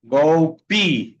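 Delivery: Golpe loud, as "GOU-pi"; final E as I. Shown as a demonstration. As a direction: correct it firmly: golpe ending in i, L as u. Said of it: "se pronuncia como I".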